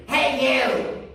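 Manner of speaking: loud, gravelly angry holler; gravelly, aggressive. Retold or imitated. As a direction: call out roughly, aggressively. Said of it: "a gravelly, aggressive voice".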